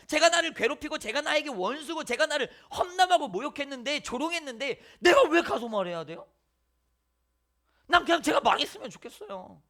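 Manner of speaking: loud, rising indignant complaint; grudging rant. Acted. A believer ranting about his enemy, loud and indignant. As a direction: vent the grudge against your enemy.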